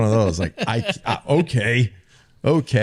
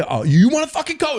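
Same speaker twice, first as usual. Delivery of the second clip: high, fast mock outburst; riled-up coach; 4 dB louder, faster than usual. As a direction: play the coach flaring up, pitched high and quick. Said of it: "And he'd be like".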